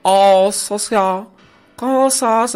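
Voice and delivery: mock high-society hello; Morelia socialite. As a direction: mimic a "popis" acquaintance from Morelia. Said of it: "que conozco ya de Morelia".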